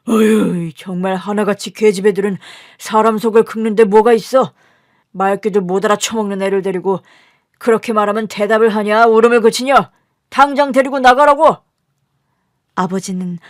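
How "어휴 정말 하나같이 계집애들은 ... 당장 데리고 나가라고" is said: exasperated scolding building to a loud shout; rant, then yell the final order; father's angry tirade. Acted. Father rages at his daughters. (점점 커지는 아버지의 호통)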